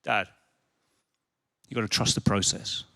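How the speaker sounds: low, soft, deadpan son; calm wise kid. Retold or imitated. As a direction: say it very quietly and matter-of-fact. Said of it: "he said this to me".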